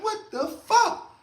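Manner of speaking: high, shocked squeak of disbelief; stunned disbelief. Retold or imitated. Retelling his stunned reaction to a friend's betrayal, high shocked disbelief. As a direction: recoil at the nerve of it. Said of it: "and I'm like".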